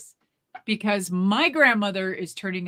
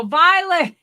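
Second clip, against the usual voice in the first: high, loud call of the name; mock yelling; 10 semitones higher, 9 dB louder than usual. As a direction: holler her name like she can't hear. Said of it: "They're still yelling".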